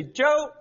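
JOE said high, voice lifted; rising Chinese tone. Shown as a demonstration. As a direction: say Joe with the pitch up. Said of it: "hear my voice go up".

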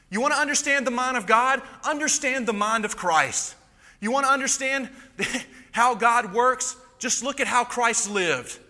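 high, emphatic pulpit voice; impassioned preaching. Real, not acted. Preacher urging the congregation to understand God through Christ, impassioned preaching. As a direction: drive the point home, pitched high.

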